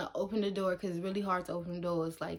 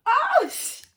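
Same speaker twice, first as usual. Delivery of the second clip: high, loud startled exclamation; acted shock; 13 dB louder than usual. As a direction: yelp it, startled, cut off mid-curse. Acting out shock at loose wheel.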